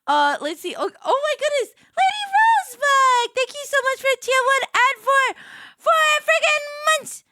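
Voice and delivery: high, loud squealing joy; freaking out. Real, not acted. Streamer thanking a subscriber while freaking out, squealing. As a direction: shriek the thanks, overwhelmed. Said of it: "kinda freaking out".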